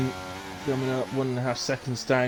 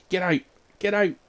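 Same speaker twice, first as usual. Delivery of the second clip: urging shout; 6 semitones higher, 5 dB louder than usual. Real, not acted. Commentator urging the driver out, higher and louder. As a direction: Get out urged higher and louder.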